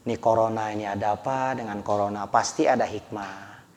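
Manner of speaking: low, hushed musing; pondering scholar. Acted. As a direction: think it through softly to yourself. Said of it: "lebih banyak tafakur mereka mah".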